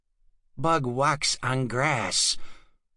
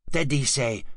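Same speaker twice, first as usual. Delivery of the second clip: usual speaking voice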